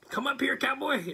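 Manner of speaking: high taunting call. Retold or imitated. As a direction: beckon the big cowboy up, playful. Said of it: "And he says".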